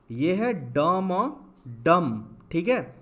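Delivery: ड, म, then डम, drawn out; spelled out slowly. Shown as a demonstration. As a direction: build डम sound by sound. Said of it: "आगे बढ़ते हैं ड की तरफ".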